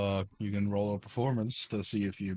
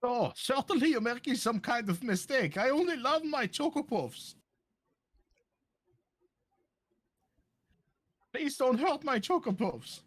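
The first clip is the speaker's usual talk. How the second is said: much higher, pleading villain voice; high pleading character voice. Acted. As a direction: beg sweetly in a high character voice. Suspect pleads with robot cop.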